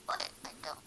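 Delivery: hushed sneaky whisper. Pretend. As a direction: breathe it, no voice. Sneaky aside.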